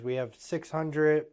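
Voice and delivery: ordinary talk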